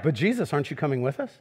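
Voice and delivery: small anxious voice asking not to be left alone; timid worry. Acted. Disciple pleading with Jesus, softly and nervously.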